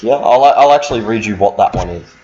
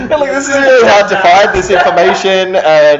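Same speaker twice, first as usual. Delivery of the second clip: loud, higher-pitched telling-off; exasperated; 10 semitones higher, 7 dB louder than usual. Real, not acted. Game master scolding the joking players, exasperated. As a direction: raise your voice over the unruly table, fed up.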